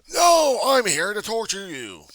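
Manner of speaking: high, loud, slow sneering heckler voice; mock heckler. Voicing a heckler who insults him, mocking voice. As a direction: jeer it like a hostile heckler.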